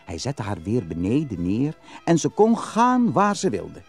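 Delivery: usual speaking voice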